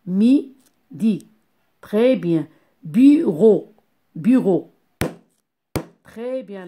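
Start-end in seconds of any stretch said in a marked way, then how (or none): 0.1-1.3 Mi-di in two separated beats; syllable by syllable. Shown as a demonstration. Teacher shows two syllables to pupils, Mi-di split apart. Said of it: "Deux syllabes". 2.8-3.7 Bureau stretched into bu, ro; syllable by syllable. Shown as a demonstration. Teacher counting syllables, Bureau split into bu and ro. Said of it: "La syllabe bu et la syllabe ro".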